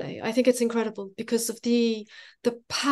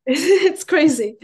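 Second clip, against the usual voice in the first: real laugh breaking round the words, louder and higher; 5 semitones higher, 7 dB louder, slower than usual. Real, not acted. Speaker reacting to the other's excitement, laughing. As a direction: say it through an amused laugh, drawn out.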